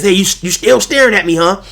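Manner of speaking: mock taunt. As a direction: sneer it like the guy at the pump. Rival taunting at gas station.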